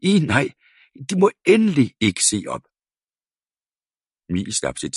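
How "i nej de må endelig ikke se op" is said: high, loud and fast in alarm; panicked shout. A narrator's reading. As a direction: blurt it out in fright.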